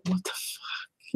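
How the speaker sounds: slow, very soft unvoiced whisper; hushed, incredulous aside; whispered disbelief. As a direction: whisper it in drawn-out exasperation. Muttered reaction to annoying coworker.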